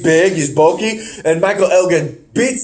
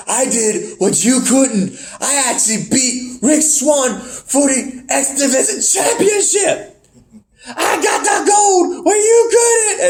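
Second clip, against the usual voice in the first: high, rising boast; mock bragging; 8 semitones higher than usual. Winner rubbing it in his teammate's face, mock bragging. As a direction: rub it in, drawing out the last words.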